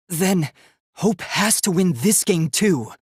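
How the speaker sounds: normal talking voice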